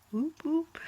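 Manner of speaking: high little whoop; a bright cheer, up high; morning cheer before the movies; excited cheer. Real, not acted. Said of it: "so excited".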